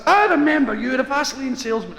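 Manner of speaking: high, loud burst of recognition; delighted recognition. Acted. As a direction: burst out as you recognise him. Third man greets salesman effusively.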